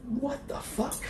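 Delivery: high, hushed "what the fuck"; squeaky, softened shock; mock disbelief. Re-enacting shock at the slapped smoothie, high soft disbelief.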